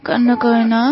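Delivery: put-on low, soft hushing of a baby; tender mother's murmur; soothing. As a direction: gently comfort a crying infant. Mother soothes her crying baby.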